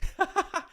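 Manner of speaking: sudden high laugh of disbelief; incredulous laugh. Real, not acted. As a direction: burst out laughing at the absurd play. Laughs at opponent's choice.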